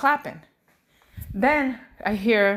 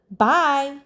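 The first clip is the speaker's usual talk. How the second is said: firm, louder bye; assertive toddler; 3 semitones higher, 5 dB louder than usual. Retold or imitated. Mom imitating her daughter at the door, assertive. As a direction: imitate a little kid announcing bye with force. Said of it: "Like, assertive now".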